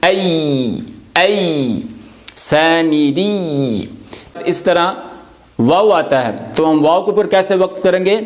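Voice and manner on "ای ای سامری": very slow سامری with the ی drawn out long; drawn-out yaa stop. Shown as a demonstration. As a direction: stretch the ی at the end of سامری. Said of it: "جیسے ہم پڑھیں گے ای". (سامری میں ی کو لمبا کھینچ کر)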